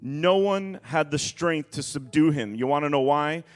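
dramatic pulpit reading, stretching weighty words; emphatic scripture reading. Acted. Preacher reading the storm and tombs story to church, emphatic preaching.

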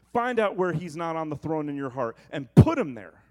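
building to a loud final command; preached charge. Acted. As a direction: urge the church to act, growing.

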